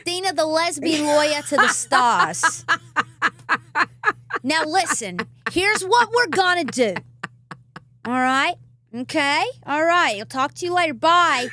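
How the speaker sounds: loud, high, brassy phone-boss impression; Dina. Singer imitating her lawyer on the phone, teasing impression. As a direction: imitate a pushy lawyer calling with orders. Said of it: "Like, that's you. That's what you call me".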